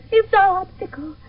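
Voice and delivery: usual speaking voice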